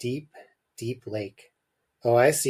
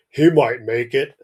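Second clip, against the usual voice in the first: put-on dad voice, louder; the father; 6 dB louder than usual. Father answers Mum about seeing snow, put-on dad voice. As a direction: voice the father as a character. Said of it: "said the father".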